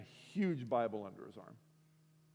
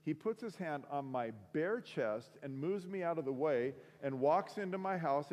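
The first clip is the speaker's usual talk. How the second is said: ordinary delivery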